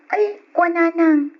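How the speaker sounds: high, loud "Ay, kwa nanang" of dismay; a teen balking at her mother; whiny protest. Acted. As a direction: whine at mom in surprise. Daughter asked to sell phone.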